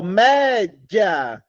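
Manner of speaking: "média" drawn out in two slow blocks; "média" slowly, as mé-dja; drawn-out syllables. Shown as a demonstration. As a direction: stretch "média", with "dia" as one sound. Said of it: "O já sai junto".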